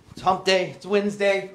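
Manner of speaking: punchy loud announcement; loud hype. Acted. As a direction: shout it to the crowd. Loud repeat of the opener.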